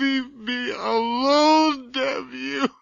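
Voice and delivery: slow, sobbing plea; tormented brother begging to be left alone; crying plea. Acted. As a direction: beg through tears. Brother breaking down.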